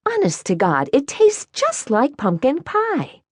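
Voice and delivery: high, bright-eyed former singer voice; sing-songy praise in a high voice; Marie voice. Acted.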